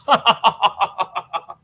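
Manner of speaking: staccato mock laugh; a run of even, separate ha-ha bursts. Streamer capping impression of scheming rival, mock cackle.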